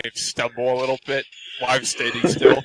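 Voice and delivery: usual speaking voice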